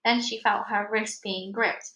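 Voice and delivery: plain reading voice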